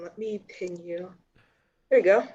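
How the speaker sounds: ordinary talk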